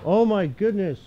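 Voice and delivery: high, delighted oh my goodness; amazed. Real, not acted. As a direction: squeal at the surprise. Spots a cat, amazed.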